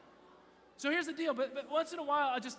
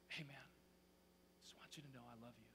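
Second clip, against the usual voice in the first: very soft, low father to his son; loving whisper; 8 semitones lower, 19 dB quieter, faster than usual. Acted. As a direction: murmur it tenderly, close to his ear. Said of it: "you whisper things that are important".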